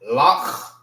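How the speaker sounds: Lach as one syllable, long rasped kh; blended letters demo. Shown as a demonstration. Teacher showing letters pronounced together, rasped Lach. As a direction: blend Lach and let the ch scrape. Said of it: "These are pronounced together".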